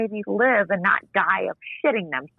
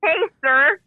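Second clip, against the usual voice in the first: mock interruption; 8 semitones higher, 4 dB louder than usual. Jokingly interrupting the caller back.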